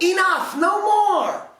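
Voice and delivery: very high, loud yell; fairies yelling. A narrator's reading. Fairies to the prince, very high yell. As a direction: yell to stop him. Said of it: "The fairies yelled".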